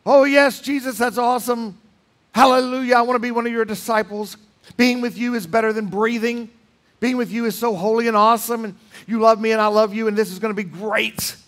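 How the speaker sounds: high, gushing overeager believer; mock eager disciple. Preacher mocking the rich man's fake eager answer, high and gushing. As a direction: ham up a starry-eyed follower gushing over Jesus. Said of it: "what did the man do".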